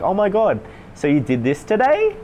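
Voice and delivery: overexcited people-pleaser, pitch climbing at each end; rapport-seeking gush. Shown as a demonstration. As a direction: gush to win approval. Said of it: "it tails up at the end".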